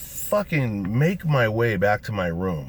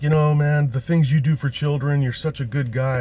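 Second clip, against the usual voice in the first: adoring fan, higher and louder; gushing fans; 7 semitones higher, 6 dB louder than usual. Retold or imitated. Fans praising the fake McGuire, gushing higher and louder. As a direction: gush like a starstruck fan. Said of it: "People are like".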